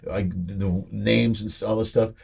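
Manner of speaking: usual speaking voice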